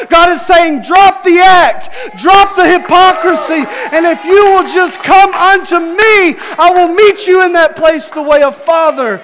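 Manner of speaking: loud, high preaching shout. Acted. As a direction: cry it out like a preacher.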